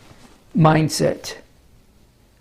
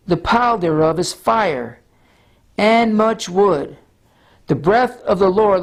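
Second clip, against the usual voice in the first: slow, solemn Bible reading; scripture reading; 2 semitones higher than usual. Acted. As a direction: read it as sacred text. Reads Isaiah aloud.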